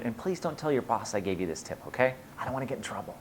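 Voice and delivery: confidential aside. Pretend. Host asking viewers not to tell the boss, confidential. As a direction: drop it down like a secret.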